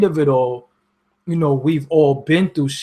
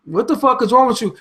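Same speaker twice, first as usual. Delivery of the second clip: higher and incredulous; baffled outburst; 7 semitones higher than usual. Retold or imitated. Reacting to threats in the comments, high and baffled. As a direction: fire it back in disbelief. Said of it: "and I'm like".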